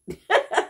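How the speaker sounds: short, high burst of real laughter; amused at the stranger's hug request; laughing. Real, not acted. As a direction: break into a quick high laugh. Laughs after hug offer.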